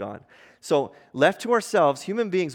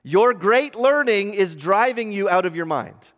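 high, booming accusation; loud voice; 7 semitones higher, 7 dB louder than usual. Acted. Festus interrupting Paul's defense on trial, loud voice. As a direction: cry it out loudly, pitched way up. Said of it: "Festus said with a loud voice".

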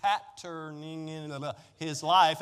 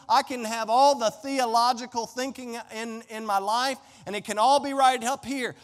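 rising, louder preacher's build; preaching; 5 semitones higher, 3 dB louder than usual. Acted. Preaching at full force.

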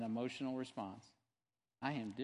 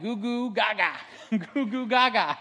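put-on high, loud baby talk; baby babble; 6 semitones higher, 9 dB louder than usual. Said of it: "it was like".

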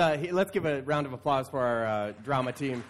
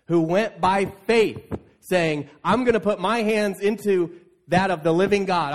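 louder, fervent sermon voice; preaching; 3 semitones higher, 5 dB louder than usual. Acted. Pastor preaching to the church, loud and fervent. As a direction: drive it home to the congregation with rising fervor.

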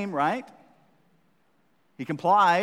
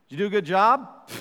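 dad quizzing; 7 semitones higher, 9 dB louder than usual. Retold or imitated. Dad questions kid.

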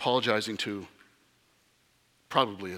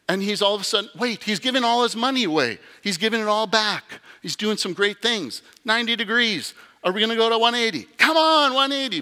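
high, loud, faster, cheering him on; building excitement; 8 semitones higher, 4 dB louder than usual. Acted. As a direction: cheer like a game's final seconds.

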